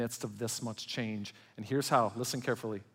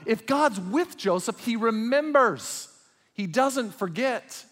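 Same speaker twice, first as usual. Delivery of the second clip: loud, high pulpit preaching; impassioned sermon delivery; fiery preaching; 7 semitones higher, 5 dB louder than usual. Acted. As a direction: preach it out with full force. Preaching at full force.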